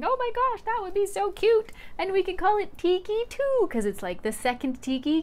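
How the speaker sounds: excited daughter. Retold or imitated. Mother quoting her daughter naming the gecko, excited high voice. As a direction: gush it like an excited kid.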